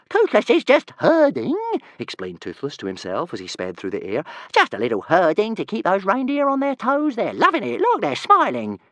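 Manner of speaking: a tiny dragon sure he is doing good; gleeful little dragon. Acted. Dragon talking to himself gleefully.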